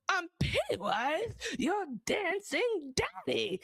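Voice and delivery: high, slow sing-song clown; Pennywise impression. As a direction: tease it like a playful clown. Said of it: "Saying, "I'm your dancing daddy," is hilarious".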